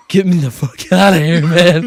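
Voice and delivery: loud retold outburst at a buddy; exasperated demand. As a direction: demand loudly to be taken away.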